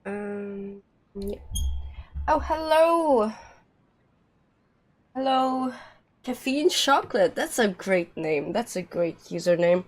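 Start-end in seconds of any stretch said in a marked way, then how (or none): none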